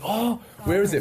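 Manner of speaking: high hopeful question; eager hope. Retold or imitated. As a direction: jump at the news. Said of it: "I'm like".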